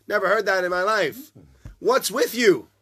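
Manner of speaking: loud, high disbelief; incredulous. Retold or imitated. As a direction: scoff in disbelief. Stranger's disbelief.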